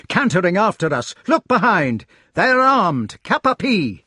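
high, loud, urgent warning; alarmed knight. A narrator's reading. Knight tricks Lancelot into looking behind, alarmed. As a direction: urge him to turn and look.

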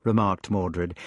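ordinary delivery

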